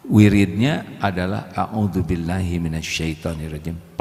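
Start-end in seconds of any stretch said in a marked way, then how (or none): 1.5-4.0 quiet, low recitation; soft under-the-breath recitation to calm anger; softly. Shown as a demonstration. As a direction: murmur the prayer gently. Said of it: "Pelan, ya. Karena kalau keras".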